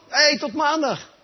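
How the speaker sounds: put-on high, slow, light girlish goodbye; cheerful teen goodbye. Preacher voicing the girl leaving school, high and light. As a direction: sing out a carefree goodbye like a young girl.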